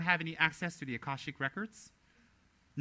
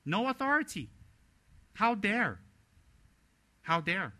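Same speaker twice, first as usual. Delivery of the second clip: much higher and louder, outraged; real indignation, voice raised high and loud; outraged rebuke; 5 semitones higher, 4 dB louder than usual. Real, not acted. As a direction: cry it out like a furious preacher. Indignant rebuke of lay judges.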